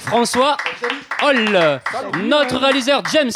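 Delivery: radio show opener. Acted. Host presenting the radio team to the audience, announcer style.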